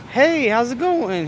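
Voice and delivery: high, slow mock cop greeting; high-pitched, drawn-out cop impression; mock friendly cop.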